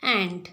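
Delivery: एंट said short and clear, the e as in "bet", not आंट; correct pronunciation. Shown as a demonstration. Teacher models एंट for children, short and clear. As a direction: model एंट firmly for the class. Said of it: "क्या पढ़ना है आपको?".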